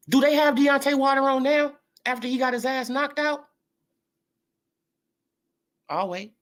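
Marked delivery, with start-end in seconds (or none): none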